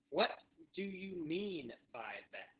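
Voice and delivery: very slow, pause after "What"; drawn-out repeat-after-me delivery; slow teaching model. Shown as a demonstration. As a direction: break "What do you mean by that" into careful words. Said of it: "so say that with me".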